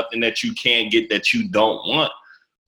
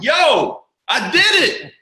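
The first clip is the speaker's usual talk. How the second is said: screaming out the big win as it lands; ecstatic shout. Retold or imitated. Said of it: "I was like".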